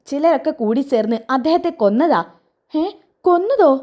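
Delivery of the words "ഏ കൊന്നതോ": shocked. Acted. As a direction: blurt it out aghast. Grandmother hears of murder. (ഞെട്ടിയ ചോദ്യം)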